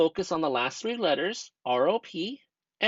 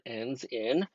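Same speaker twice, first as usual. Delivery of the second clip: 1 slow emphasis; 5 semitones lower, much slower than usual. Shown as a demonstration. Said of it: "ends in C V C".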